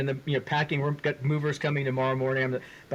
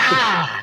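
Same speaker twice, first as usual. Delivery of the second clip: mock pain yelp; 5 semitones higher, 8 dB louder than usual. Burned by the backpack.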